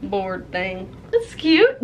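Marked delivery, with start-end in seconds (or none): 1.1-1.8 giggly squeal. Real, not acted. Delighted squeal over gift.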